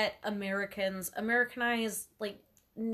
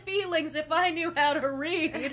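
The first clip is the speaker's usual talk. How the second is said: mock taunt; 6 semitones higher, 6 dB louder than usual. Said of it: "And they're like".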